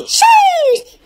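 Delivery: high, drawn-out sheesh; excited sheesh. Acted. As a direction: throw out a hype ad-lib. Rap ad-lib.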